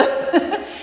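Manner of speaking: a quick amused laugh; breathy laugh. Real, not acted. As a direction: laugh at the memory. Laughing at husband's reaction.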